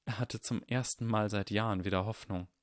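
ordinary delivery

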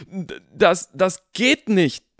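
breathless, stammering gasps; gasping for air. Acted. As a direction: gasp it out in shock. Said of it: "keuchte er". (keuchend, nach Luft ringend)

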